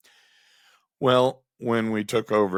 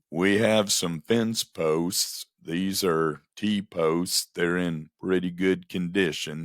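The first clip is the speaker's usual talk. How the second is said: mimicking a very slow, plodding radio host; really slow. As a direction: drag each phrase out lazily. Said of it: "going, like, really slow".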